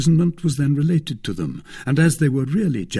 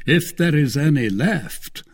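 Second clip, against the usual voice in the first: higher-pitched mocking grumble; jeering grumble; 5 semitones higher, 4 dB louder than usual. A narrator's reading. Athos taunts about the cellar's wine, jeering grumble. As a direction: grumble it with a jeer. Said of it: "grumbled the jeering voice of Athos".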